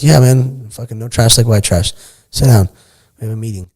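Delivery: bold retort. Retold or imitated. Telling Tyson off in the meeting.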